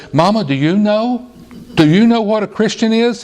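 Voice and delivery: put-on high, eager child's voice; a small boy tugging at his mother in church, voiced high and eager; little boy's voice. A narrator's reading. Boy asks mother mid-sermon, high childlike voice. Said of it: "whispered to his mother".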